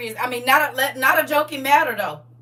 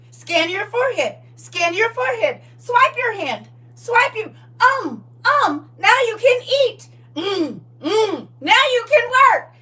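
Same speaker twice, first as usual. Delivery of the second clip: high, loud robotic commands; NPC voice; 12 semitones higher, 9 dB louder than usual. Pretend. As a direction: bark orders like a game NPC. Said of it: "my version of the N P C".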